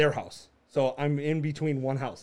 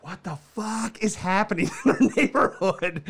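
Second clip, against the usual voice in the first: higher, incredulous retold outburst breaking into laughter; exasperated disbelief; 4 semitones higher than usual. Husband to wife coming inside, exasperated disbelief.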